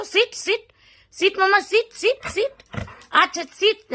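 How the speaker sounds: sing-song high সিট with a rising end; high sit command. Real, not acted. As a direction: coax the dog up high and bright.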